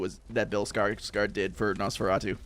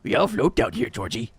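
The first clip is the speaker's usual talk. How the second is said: Pennywise voice. Acted. Quoting Pennywise to Georgie.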